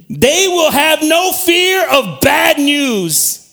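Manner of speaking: very slow, loud, high proclaimed verse; preaching shout. Acted. Preacher shouting the verse to his congregation, slow. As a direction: shout the promise out, stretching every word.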